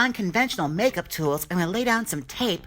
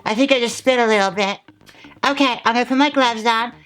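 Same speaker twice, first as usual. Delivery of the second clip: louder, stiff lips, face barely moving; stiff-faced talking; 3 semitones higher, 8 dB louder than usual. Real, not acted. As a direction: talk without moving the face, projecting. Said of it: "if you're wondering why I'm talking like this".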